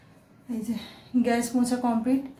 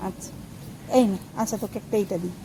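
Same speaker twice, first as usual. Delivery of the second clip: lower, softer, gently handing it over; tender offer; 4 semitones lower, 4 dB quieter than usual. Real, not acted. Giving a ripe mango to child. (নরম গলায় আদর করে আম দেওয়া)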